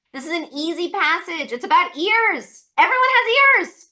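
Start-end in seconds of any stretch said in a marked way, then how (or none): 2.8-3.9 much higher and louder; excited emphasis. Real, not acted. Teacher excitedly reassuring students the passage is easy. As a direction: sell it with a burst of enthusiasm.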